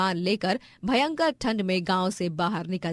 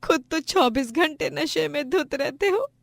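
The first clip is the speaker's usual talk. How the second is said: raised, crying scold; tearful reproach; 6 semitones higher than usual. Acted. Wife shames her drunkard husband, tearfully angry. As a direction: berate him through tears.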